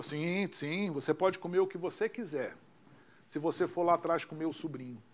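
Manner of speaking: quiet murmured offer; low and quiet. Retold or imitated. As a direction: drop the voice low as if nobody should hear. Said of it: "Baixo, baixo isso".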